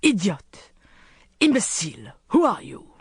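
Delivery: outraged villain demanding an answer; angry outburst. A narrator's reading. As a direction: hurl the insults at her. Villain raging after striking her.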